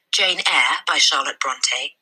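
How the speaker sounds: ordinary delivery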